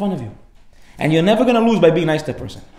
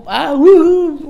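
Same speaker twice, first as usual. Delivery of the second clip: high, loud drawn-out whoop; mock joy; 13 semitones higher, 8 dB louder, much slower than usual. Father whose son is coming to kill him, mock joy. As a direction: whoop with absurd delight. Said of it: "I'm so happy".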